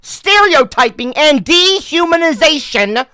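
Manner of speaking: loud, high, over-the-top read-aloud; dramatic reading. Acted. Streamer reads article to chat in a booming dramatic voice. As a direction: declaim the article like a grand announcement.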